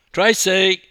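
loud, high, quick call; interrupting. A narrator's reading. Journalist interrupting a couple for a photo, calling out. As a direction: cut in loudly and rattle off the request. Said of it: "interrupted sports journalist Wayne Smith".

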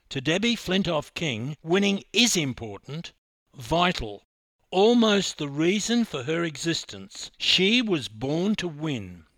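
ringing tribute to the champion; impassioned tribute. A narrator's reading. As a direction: build it up like a eulogy.

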